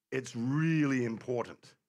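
slow, with "really" drawn out and leaned on; stress on really. Real, not acted. Preacher on the psalmist wanting attention, stress on "really". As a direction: hit "really" hard and stretch it.

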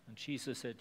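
usual speaking voice

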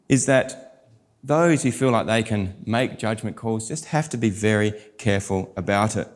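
usual speaking voice

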